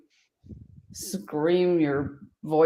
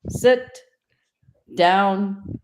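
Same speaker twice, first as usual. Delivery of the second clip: firm, louder "Sit down" with a higher, sharp "Sit"; command tone; 3 semitones higher, 4 dB louder than usual. Shown as a demonstration. Trainer demonstrates the command tone to a dog, firm. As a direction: bark "Sit" like an order. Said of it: "the command tone".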